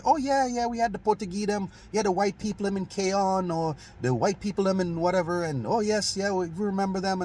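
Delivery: put-on old-timer fondly recalling the Portuguese in a Saint Kitts accent; older Kittitian folk. Elders reminiscing to the speaker about Portuguese neighbours, high Kittitian lilt. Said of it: "them say".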